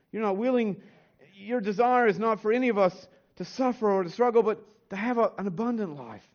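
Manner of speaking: prayer. Acted. Pastor praying for his church, hushed and reverent.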